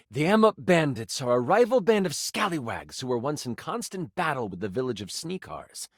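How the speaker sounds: normal talking voice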